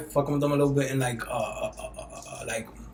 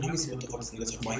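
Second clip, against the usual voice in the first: quick aside; 5 semitones lower, 12 dB quieter, much faster than usual. Retold or imitated. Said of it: "I was just like".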